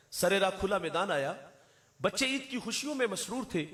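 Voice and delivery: ordinary delivery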